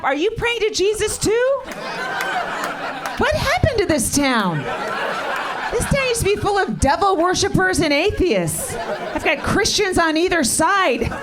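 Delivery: high, disbelieving comic rant; incredulous rant. Real, not acted. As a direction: rant at the crowd, half laughing. Comic ranting at praying audience.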